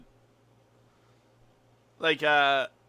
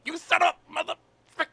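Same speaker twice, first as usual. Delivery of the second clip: squeaky, high playful cursing; pitched way up in teasing disbelief; high mock insult; 12 semitones higher, faster than usual. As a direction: greet a friend with a high, fond insult. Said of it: "I was the quietest, cleanest".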